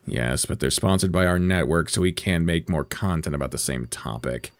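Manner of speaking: low, soft, measured rebuttal; subdued, deep-pitched correction; unimpressed colleague. Acted. Plays network colleague answering host.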